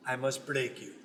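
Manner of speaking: very low, soft menacing Russian; quiet Ivan Drago threat. Acted. Drago quote, deep and soft.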